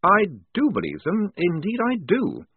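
defiant, a little nervous; nervous defiance. A narrator's reading. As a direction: stand up to him though rattled. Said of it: "shaking with nervous defiance".

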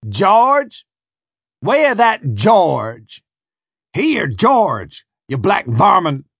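loud, high barked shouts; harsh barking commands. Acted. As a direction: bark the name across the deck. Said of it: "the overseer shouted".

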